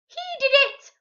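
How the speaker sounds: very high, loud parrot shriek. A narrator's reading. Parrot shrieks to blame Pete, very high loud shriek. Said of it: "the purple parrot shrieked".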